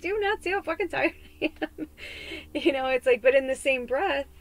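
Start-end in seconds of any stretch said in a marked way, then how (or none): 0.0-1.9 exasperated outburst. Retold or imitated. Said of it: "I'm like".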